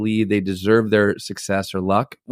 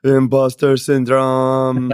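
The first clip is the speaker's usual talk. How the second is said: slow, shaky nervous falsetto; 4 semitones higher, 4 dB louder, much slower than usual. Real, not acted. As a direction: say it with jittery nerves. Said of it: "that's my nervous voice right there".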